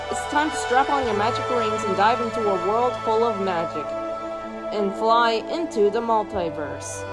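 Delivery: ordinary delivery, nothing marked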